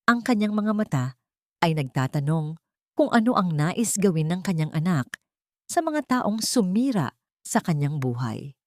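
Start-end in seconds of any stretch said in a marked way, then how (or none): none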